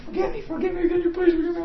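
high-pitched begging voice; mock high, urgent plea; imitated pleading wife. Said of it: "But she said".

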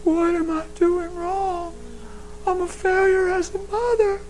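high, slow, tearful mother's plea; distraught mother. Retold or imitated. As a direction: play the mom near tears asking the pastor. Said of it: "they come to church and they go".

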